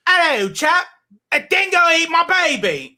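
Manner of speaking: high, loud put-on accent; Ree's accent. Shown as a demonstration. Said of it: "say something in Ree's voice".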